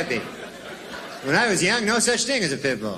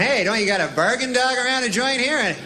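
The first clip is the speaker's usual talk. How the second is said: haggling customer; 7 semitones higher than usual. Retold or imitated. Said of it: "But I was going".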